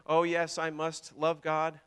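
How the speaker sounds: mock robot on a level monotone; poor robot imitation. Preacher mimicking robots made to love God, poor robot imitation. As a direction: recite it like a dutiful robot, no inflection. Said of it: "my poor imitation of a robot".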